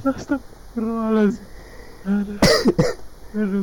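high, loud laughing exclamation; exasperated exclamation. Real, not acted. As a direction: blurt it out mid laughing fit. Laughing fit, crying from laughter.

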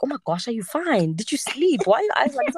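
imitating worried gym buddies, pitch climbing; alarmed concern. Gym guys asking about her puffy eyes, alarmed. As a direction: fire off questions with mock alarm. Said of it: "The guys at the gym were like".

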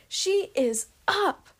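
high, drawn-out call; slow, high shout to the others; yelled announcement. A narrator's reading. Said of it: "a female yelled".

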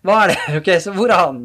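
searching question broken by laughter; laughing. Real, not acted. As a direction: ask it through a laugh. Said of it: "laughing while asking".

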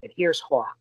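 normal talking voice

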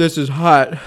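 loud, panting from the heat; apologising through hot-mouthed huffs; heavy breathing. Real, not acted. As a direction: puff between phrases from the burn. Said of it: "Sorry for the breathing".

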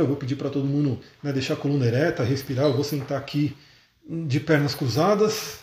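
normal talking voice

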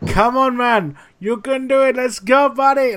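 put-on high, loud Colombian-accented pep talk. Head chef motivating the busy kitchen, imitated Colombian accent. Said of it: "this homage to his accent".

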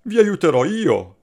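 the swallow boldly promising to help; a touch of resolve; determined exclamation. Acted. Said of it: "esclamò decisa".

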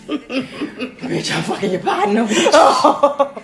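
laughing. Real, not acted. Laughing threat to cheating partner.

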